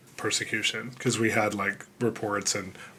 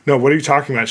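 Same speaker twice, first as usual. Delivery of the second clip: loud, higher disbelief; louder incredulous protest; 4 semitones higher, 10 dB louder, faster than usual. Retold or imitated. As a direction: push back in disbelief.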